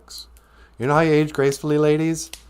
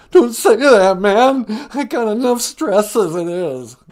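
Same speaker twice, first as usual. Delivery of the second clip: high, loud pleading; terrified lion caller; 8 semitones higher, 7 dB louder than usual. Acted. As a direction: beg for help in a panic.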